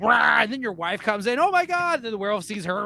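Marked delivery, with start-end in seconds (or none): 0.0-0.4 louder short beast roar; werewolf roar. Retold or imitated. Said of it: "The werewolf's just like". 1.4-2.0 high shocked gasp of a line; the wife seeing the carnage, voice leaping up; horrified wife. Acted.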